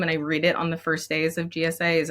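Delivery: normal talking voice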